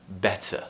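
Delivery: "Better" with an unvoiced true T; crisp T in the middle of "Better", no tap; clear T. Shown as a demonstration. Teacher closes the example for learners, "Better" with clear T. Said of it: "unvoiced T sound".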